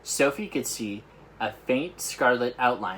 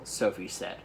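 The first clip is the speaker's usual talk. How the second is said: plain reading voice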